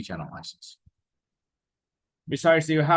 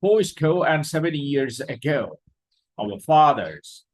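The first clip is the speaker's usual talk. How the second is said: Fourscore and seven years ago declaimed loud and high; reciting a speech; 4 semitones higher, 6 dB louder, slower than usual. Shown as a demonstration. Teacher reading Lincoln's address aloud to students, declaiming. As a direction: orate it from a podium. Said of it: "let's read".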